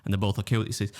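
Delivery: usual speaking voice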